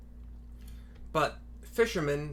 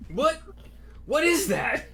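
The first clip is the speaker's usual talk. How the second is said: high startled question; 13 semitones higher, 5 dB louder than usual. Acted. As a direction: react in sudden alarm. Old man startled by shout.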